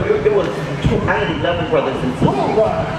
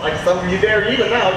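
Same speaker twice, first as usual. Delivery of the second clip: ordinary delivery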